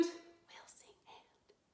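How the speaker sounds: soft whispered echo of the line. Shown as a demonstration. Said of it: "like an echo".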